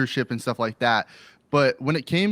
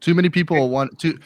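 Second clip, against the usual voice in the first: ordinary talk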